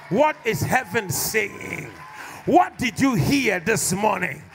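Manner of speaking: loud, slow demanding shouts; shouted questions. Real, not acted. Pastor challenging the congregation to hear God. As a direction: bark each question at them.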